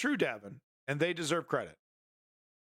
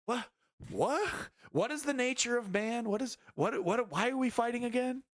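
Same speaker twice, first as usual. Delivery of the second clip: soldier pondering; 10 semitones higher than usual. Retold or imitated. Streamer voices a confused soldier in the trench, high bewildered. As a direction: wonder aloud like a dazed man in the trench. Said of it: "being like".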